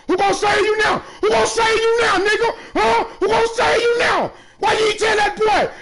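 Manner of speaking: yelling at the opponent who missed; taunting yell. Real, not acted. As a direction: rub it in, shouting. Taunting opponent after a miss.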